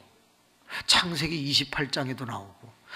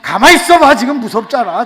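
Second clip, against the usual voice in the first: high, loud, flustered cut-in; spooked interruption; 9 semitones higher, 15 dB louder than usual. Retold or imitated. Scared pastor stopping the girl's testimony, loud fluster. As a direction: cut them off in mock alarm.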